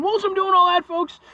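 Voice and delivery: comic high-pitched squeal; squeaky high voice. Acted. Repeated phrase, squeaky high take.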